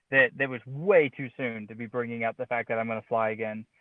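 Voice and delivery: usual speaking voice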